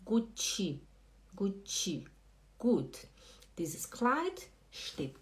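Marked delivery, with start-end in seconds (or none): 0.1-2.1 gut mispronounced as "Gucci"; Brazilian-style goo-tchi; wrong added vowel. Shown as a demonstration. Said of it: "não". 2.6-3.1 gut ending crisply on t; clean final T. Shown as a demonstration. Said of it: "é gut".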